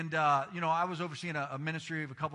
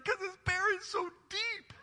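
laughing. Retold or imitated. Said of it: "I was like".

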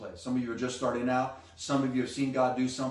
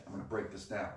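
ordinary talk, nothing marked

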